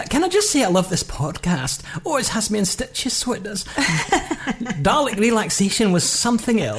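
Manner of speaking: amused Scottish reading, like their mother; Scottish lilt with laughter creeping in; put-on Scottish accent. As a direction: read it in a playful Scottish accent. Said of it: "put on the Scottish accent".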